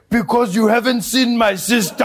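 loud, high-pitched put-on elder voice; hammed-up grandfather impression; grandfather's punchline. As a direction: deliver it triumphantly as the old grandfather. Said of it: "my grandfather turns to him and he's like".

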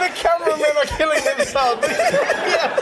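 high laughing shout; real laughter, crew in stitches; cracking up. Real, not acted. Host cracking up.

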